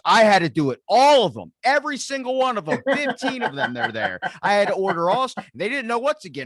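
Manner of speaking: loud, high-pitched retelling of a worked-up sandwich worker; exasperated mock outburst. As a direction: act out her fed-up rant at high volume. Acting out exasperated sandwich woman's outburst.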